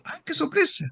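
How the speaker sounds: high, exaggerated "qué sorpresa"; sarcastic fake astonishment; mock surprise. Analyst's mock surprise to patient.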